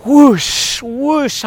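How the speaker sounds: a lighter, lifted whoosh of surf; imitated wave rushing by. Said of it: "this big wave".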